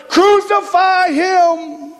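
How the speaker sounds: intensify the crowd's call, stretching it high. Acted. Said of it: "even louder".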